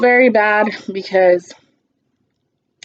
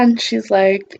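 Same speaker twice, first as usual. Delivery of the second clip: normal talking voice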